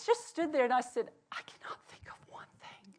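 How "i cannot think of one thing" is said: unvoiced whisper of defeat. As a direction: whisper it as if no song comes. Panicked prayer retold.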